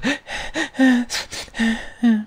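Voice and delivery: high, soft gasping fake sobs; mock sobbing. Commentator mimics woman walking away from dude bros, mock sobbing gasps. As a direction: gasp out high pretend sobs. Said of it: "she walks away with a".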